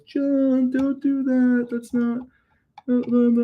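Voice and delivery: high-pitched mock scolding voice; mock nagging critic. Imitating a critic who tells John not to do the box hack. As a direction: squeak it out like a fussy critic. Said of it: "someone says".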